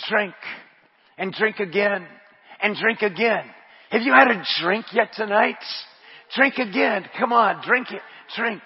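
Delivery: normal talking voice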